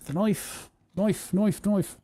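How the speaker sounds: put-on light Australian accent, "knife" said as noife; a hint of Aussie on "knife". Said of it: "I cannot do the Australian accent".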